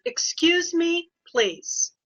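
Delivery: slow, firm teacher warning, Excuse lifted high; stretched Excuse me, please to settle a class; pretend mean voice. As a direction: put on a mock-stern teacher voice. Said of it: "It's her pretend mean voice".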